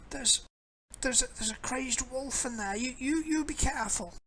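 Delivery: put-on high old woman warning; a fretful crone cautioning; old lady voice.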